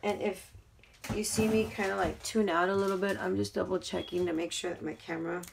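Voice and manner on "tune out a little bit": ordinary delivery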